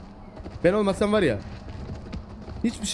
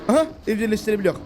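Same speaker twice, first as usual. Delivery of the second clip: ordinary delivery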